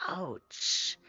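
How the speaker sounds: soft breathy whisper of "Ouch.". Real, not acted. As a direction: whisper it, wincing for her. Sympathetic wince at viewer's injury.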